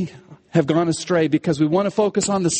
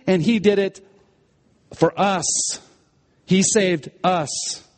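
ordinary talk

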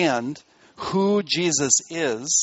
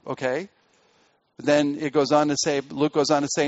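normal talking voice

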